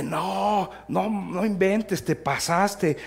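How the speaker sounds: high, drawn-out teasing protest; mock disbelief. Pastor teasing his roommate over the mix-up, mock disbelief. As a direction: stretch the words in imitated disbelief. Pastor reacts to slapping prank story.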